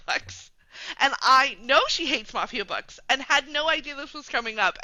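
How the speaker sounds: ordinary talk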